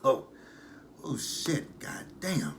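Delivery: strained after straining voice; throat hurting, recovering; sore throat. Real, not acted. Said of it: "'cause it hurts".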